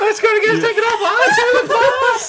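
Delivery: very high, loud through laughter; real hysterical amusement; laughing outcry. Real, not acted. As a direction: shriek it while cracking up. Cracking up at the slip.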